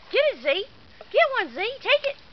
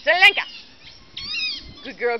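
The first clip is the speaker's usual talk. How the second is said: ordinary talk, nothing marked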